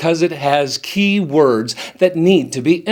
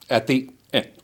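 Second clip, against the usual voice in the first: "at the end" trailing away softly, word by word; falling off; 3 semitones lower, 7 dB quieter than usual. Shown as a demonstration. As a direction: let "at the end" drop off as a bad example. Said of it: "Don't let that word fall off".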